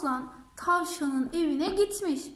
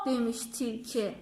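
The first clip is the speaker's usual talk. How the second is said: plain reading voice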